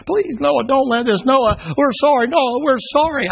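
very high, loud desperate cry; the drowning crowd begging Noah to open; frantic begging. Acted. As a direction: scream for mercy at the door. Drowning people beg at ark door.

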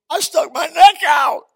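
high, slow shout; shouted punchline of the testimony; preacher's shout. Real, not acted. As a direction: cry it out from the pulpit. Preacher's climax on risk.

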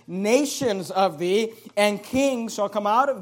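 "nations of thee" drawn out very slowly, "kings" stressed; slow, deliberate weight on "nations" and "kings"; emphasis. Shown as a demonstration. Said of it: "notice the emphasis".

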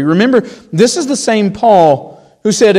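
normal talking voice

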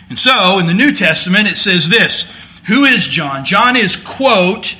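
fervent preaching. Acted. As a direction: drive the point home to the congregation. Preaching at full force.

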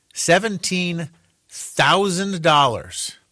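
slow, weighty dramatic reveal. Shown as a demonstration. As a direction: drag out each word for impact. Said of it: "More accurately".

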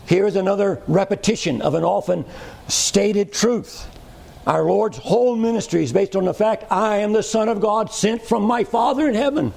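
high, ringing preacher's voice, hammering "Father"; full-force preaching. Acted. Preacher declaring Christ's sonship to the congregation, high and fervent. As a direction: lift it up and press each "My Father".

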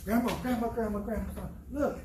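put-on high, eager child calling out; a five-year-old tugging for attention; small boy showing off his drawing, high and eager; excited little boy.

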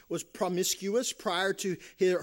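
usual speaking voice

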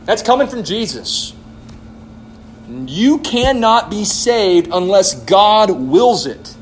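usual speaking voice

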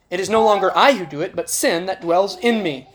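ordinary talk

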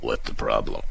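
Frank in a low, dull, clueless voice. Retold or imitated. A clueless partner answering in a low, soft, dull voice. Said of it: "Bob and Frank".

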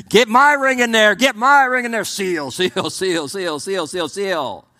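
high, loud, frantic mock voices; mocking the eager conspirators. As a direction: ham up the schemers rushing to seal it. Mocking the enemies sealing the stone.

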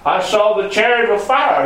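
high, ringing testimony; preacher voicing an awestruck witness; impassioned proclamation. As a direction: proclaim the vision at a high pitch. Prophet proclaiming his message.

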